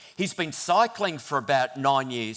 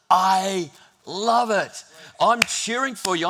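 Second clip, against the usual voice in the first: drawn-out, louder, higher gush of delight; excited cheer; 4 semitones higher, 5 dB louder, slower than usual. Retold or imitated. Friend telling Rob he loves it, mock excited cheer. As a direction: gush over them like a proud fan. Friend's encouragement voiced in story.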